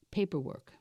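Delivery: ordinary talk, nothing marked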